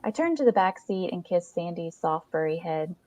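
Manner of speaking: ordinary delivery, nothing marked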